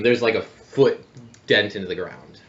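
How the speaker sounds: ordinary delivery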